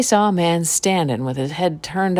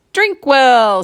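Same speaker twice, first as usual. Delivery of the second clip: eager call; 9 semitones higher, 7 dB louder than usual. Acted. Drinkwell answers the witch's challenge.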